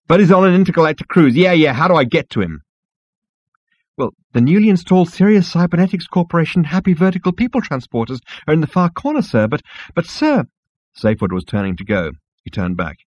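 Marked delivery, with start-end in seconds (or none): 0.1-2.6 fast, loud, impatient; impatient interruption. Acted. Cutting off impatiently. 4.0-10.4 flustered insect voice. Acted. Insect character voice.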